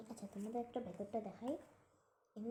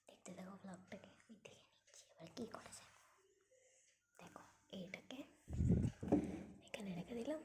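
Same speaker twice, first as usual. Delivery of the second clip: whispering; 4 dB louder than usual. Real, not acted. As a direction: keep it to a whisper while packing. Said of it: "আস্তে আস্তে বলছি".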